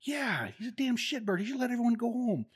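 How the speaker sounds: very high, loud squeaky mock voice; mocking other sergeant. Staff sergeant chiming in on the insult, high squeaky mock. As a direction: caricature the sergeant who joined in.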